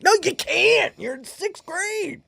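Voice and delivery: high, squeaking exasperation; exasperated teacher. Retold or imitated. Teacher arguing with sixth graders over phones, high-pitched exasperated retort.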